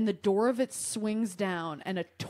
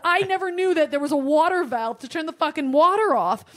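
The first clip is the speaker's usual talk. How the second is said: exasperated; 8 semitones higher, 7 dB louder than usual. Real, not acted. Defensive exasperated outburst.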